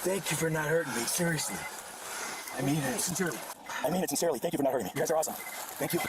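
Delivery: low, earnest thanks to the officers; grateful, almost pleading; sudden gratitude. Real, not acted. Suspect thanking officers after arrest, earnest low thanks.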